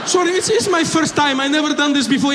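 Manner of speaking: put-on high, whining accented excuse; apologetic bomber. Novice bomber apologising to passenger, flustered accented voice. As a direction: excuse yourself in a put-on Middle Eastern accent.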